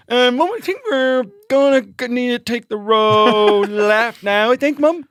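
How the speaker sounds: put-on high, childlike whine asking mum; like a small child fretting over a map; little kid voice. Child to his mum in the car, high kid voice.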